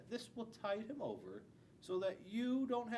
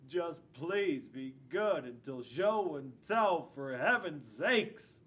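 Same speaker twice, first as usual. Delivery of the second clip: pleading; 4 semitones lower, 6 dB louder, slower than usual. Acted. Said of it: "And then you'll tell him".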